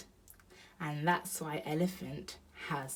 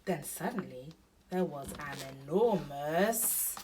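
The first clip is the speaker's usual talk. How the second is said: plain reading voice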